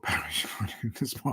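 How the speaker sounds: breathy whisper with a laugh; a secret little aside to the side. Real, not acted. Aside muttered under breath.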